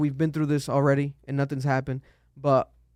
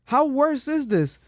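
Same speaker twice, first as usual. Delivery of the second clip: high, drawn-out disbelief; incredulous outburst; 10 semitones higher, slower than usual. Retold or imitated. Host answers the governor's quote, high exasperated disbelief. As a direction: stretch it out, pitched high in protest. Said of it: "it's like".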